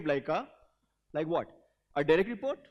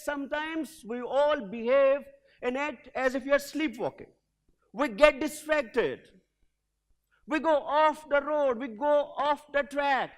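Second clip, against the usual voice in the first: slow inspirational speech; 4 semitones higher than usual. Acted. Message to audience.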